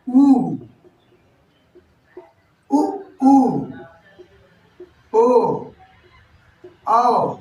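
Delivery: उ, ओ, औ sounded out one by one; back vowel demo. Shown as a demonstration. Teacher modelling back vowels for the class. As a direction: give each back vowel on its own. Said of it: "वर्णों का उच्चारण होता है".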